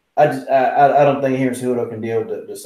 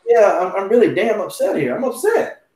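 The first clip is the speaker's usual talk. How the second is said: retold complaint; 8 semitones higher than usual. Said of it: "I said".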